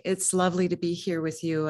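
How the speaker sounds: ordinary talk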